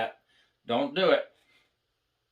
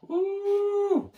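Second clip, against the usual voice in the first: mock high ooh. Mock reaction to the taunt.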